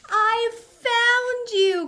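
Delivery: high, loud, slow delighted shout; triumphant joyful cry; happy cry. A narrator's reading. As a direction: beam it out with a grin. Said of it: "cried George with a big happy grin".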